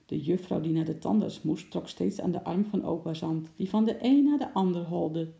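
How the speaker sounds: ordinary delivery, nothing marked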